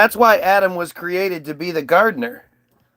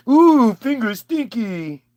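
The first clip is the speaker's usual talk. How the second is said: slow, high simpleton voice; slow broken-English dimwit; dim Eve; 4 semitones higher, slower than usual. Retold or imitated. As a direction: drag it out like a dopey caveman. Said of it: "Eve answers snake".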